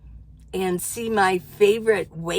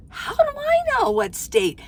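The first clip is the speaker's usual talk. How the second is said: exasperated. Real, not acted. Exasperated question.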